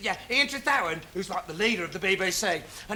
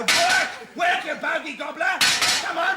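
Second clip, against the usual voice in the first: loud, higher yelling at the clock; frustrated shout; 6 semitones higher, 5 dB louder, slower than usual. Real, not acted. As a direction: bark at the clock to get it working.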